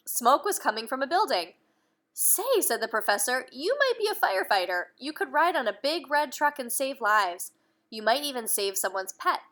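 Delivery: put-on reading aloud to children; lively bedtime-story narration; storybook reading. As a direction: tell the story to little ones. Reading a picture book aloud.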